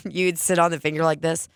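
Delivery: ordinary talk, nothing marked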